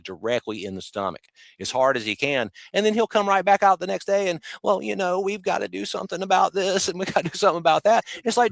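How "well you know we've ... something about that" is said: mock politician. Mocking Kennedy's casual talk.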